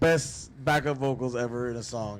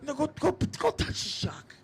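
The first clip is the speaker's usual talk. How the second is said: Joe Pesci impression. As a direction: needle him like a menacing gangster. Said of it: "Pesci would've came out of me".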